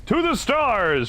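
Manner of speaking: high, slow wondering shout; gleeful cry. Real, not acted. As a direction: sing it up to the sky. Body flung into the sky.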